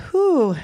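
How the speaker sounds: high "whew" of bracing oneself; real nervous "whew" before going on; steadying exhale. Real, not acted. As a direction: blow out a steadying breath. Steeling herself to keep telling.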